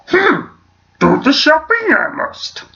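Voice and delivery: very high, croaky little alien sage; Yoda. Acted. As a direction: demonstrate the squeaky wise-creature impression. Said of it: "Yoda's easy".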